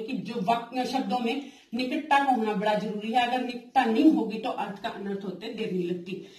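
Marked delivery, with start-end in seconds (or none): none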